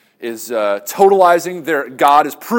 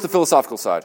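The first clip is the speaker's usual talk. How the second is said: usual speaking voice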